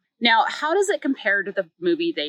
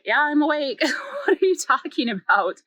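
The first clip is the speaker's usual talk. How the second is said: put-on higher, half-laughing sleepy girlfriend; confused girlfriend; 7 semitones higher than usual. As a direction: play her baffled, almost laughing.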